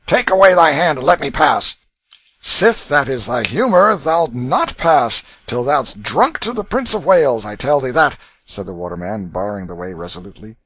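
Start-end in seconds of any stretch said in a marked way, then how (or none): 0.0-1.8 loud, gruff demand; rough demand. A narrator's reading. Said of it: "answered Canty roughly". 2.4-8.2 tipsy waterman. A narrator's reading. Waterman bars the way.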